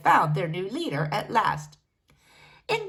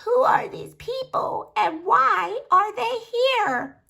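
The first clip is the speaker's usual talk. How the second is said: put-on high, slow, quavering old granny; an ancient great-granny asking, cracked but clear; cracking old voice; 12 semitones higher, slower than usual. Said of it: "her voice cracking but clear".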